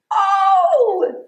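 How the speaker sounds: high, loud gasp of delight. Acted. Teacher discovering eggs in the song, delighted cry. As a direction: exclaim with surprise and joy.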